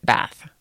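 Bath in a plain American accent, short a; own American accent. Shown as a demonstration. Friend advising traveler to embrace it, American accent. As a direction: say Bath as an American, vowel as in cat. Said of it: "embrace the fact that that's your accent".

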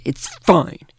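mock-emphatic reassurance; insistent brush-off. Woman reads out a texted reply, emphatically dismissive. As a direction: imitate a firm texted refusal. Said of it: "she writes back".

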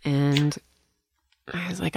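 ordinary talk

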